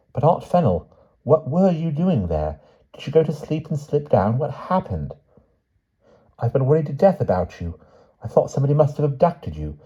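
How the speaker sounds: high, urgent questioning voice; anxious, high-pitched niece; worried niece. A narrator's reading. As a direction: pour out worry to a frail aunt.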